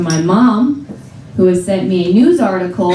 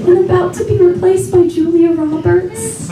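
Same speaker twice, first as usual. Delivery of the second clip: high, slow mock-weepy mom voice; tearful mom impression; 8 semitones higher than usual. Comedian voicing her tearful mom during Stepmom, high and slow. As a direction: drag it out like a crying mother. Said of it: "She's like".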